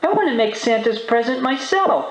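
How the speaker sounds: put-on high-pitched insistent kid; young boy voice. A narrator's reading. Arthur insisting on cooking alone, high boy voice. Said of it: "said Arthur".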